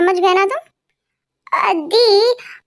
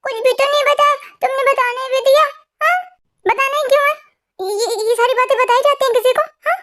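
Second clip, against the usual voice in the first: higher-pitched, mild telling-off; scolding; 5 semitones higher than usual. Acted. Boy taking the girl to task for telling his sister, scolding. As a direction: scold with insistent questions, without shouting. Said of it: "तुम मुझे डांट रहे हो".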